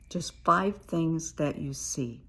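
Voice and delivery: ordinary delivery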